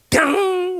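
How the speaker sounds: drawn-out, ringing "ttang" of a gong; gong imitation. Shown as a demonstration. Preacher showing listeners the gong heard at shaman rites, gong imitation. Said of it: "징 소리 나고".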